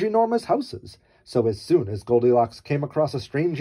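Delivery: plain reading voice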